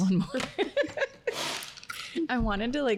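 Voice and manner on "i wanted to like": laughing through the words; giggling as she talks. Real, not acted. Laughing before weighing in.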